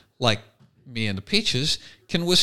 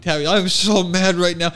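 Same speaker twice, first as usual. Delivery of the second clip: higher, louder mock-mad little girl; Tabby being mad; 5 semitones higher, 5 dB louder than usual. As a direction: imitate a grinning kid claiming to be mad. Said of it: "when Tabby says she's really mad".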